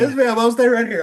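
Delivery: high, loud, hurried-to-obey mock reply; hammed-up submission to a bossy lady; retold meek compliance. Cowed reply to retold scolding.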